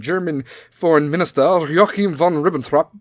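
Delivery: hammed-up German announcer's accent; punchy, theatrical fake German accent; mock German accent. Shown as a demonstration. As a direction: relish the name in a put-on German accent. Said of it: "you have to say it like that".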